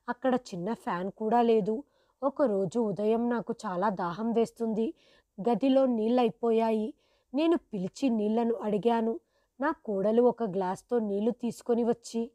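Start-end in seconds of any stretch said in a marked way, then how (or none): none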